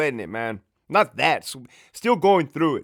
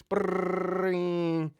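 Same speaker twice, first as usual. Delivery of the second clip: mock phone ring sound; playful imitated ring; 6 semitones higher, 4 dB quieter than usual. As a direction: roll the r like a ringing phone.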